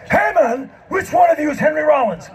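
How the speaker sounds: high, excited rocker bursting in. Retold or imitated. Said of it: "this human tornado, comes running in".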